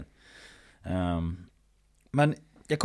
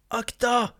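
warning shout; 11 semitones higher than usual. Retold or imitated. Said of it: "där jag hör någon så här".

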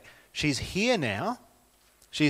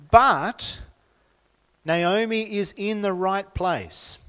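higher, louder, building sermon fervour; rising preaching; 5 semitones higher, 5 dB louder than usual. Acted. Pastor urging the congregation toward hope, fervent and loud. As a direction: preach it out with growing conviction.

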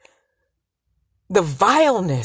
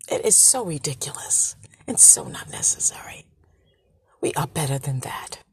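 hushed whisper, pitch dropped low; 6 semitones lower, 9 dB quieter than usual. Real, not acted. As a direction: breathe it out as a whisper. Sudden whispered aside.

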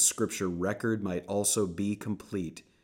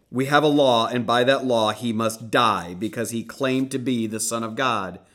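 loud, higher demand; insistent shout; 4 semitones higher, 10 dB louder than usual. Acted. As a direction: press the law on him loudly.

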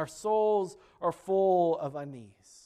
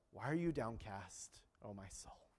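much softer, gentle question fading away; soft voice of the Lord; 8 semitones lower, 12 dB quieter than usual. Retold or imitated. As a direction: let the Lord ask it softly, almost to nothing. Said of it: "And the Lord said".

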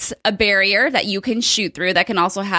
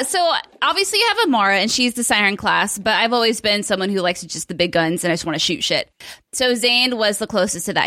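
ordinary talk

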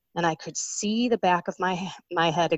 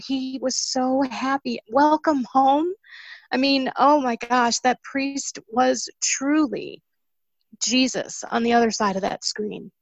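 choked up, tearful; 6 semitones higher than usual. Real, not acted. Woman recalling the priest's welcome, tearfully choked up. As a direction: speak through a real lump in the throat. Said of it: "Now I can't see 'cause my eyes are filled with tears".

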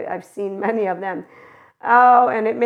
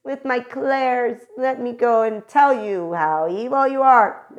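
lightly voiced smug saint, drawn-out clairs; a hint of holier-than-thou scolding; mock sanctimony; 4 semitones higher than usual. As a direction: play the self-righteous mystic. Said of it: "won't be like".

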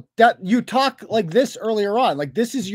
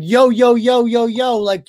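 mocking her "yo"; 2 semitones higher, 4 dB louder, slower than usual. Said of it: "It's the little, like".

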